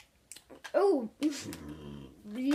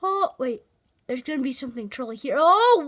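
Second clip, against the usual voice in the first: ordinary talk, nothing marked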